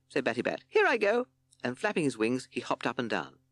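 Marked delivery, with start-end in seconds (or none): 0.7-1.3 high, loud bat squeak; squeaky bat. A narrator's reading. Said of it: "squeaky voice".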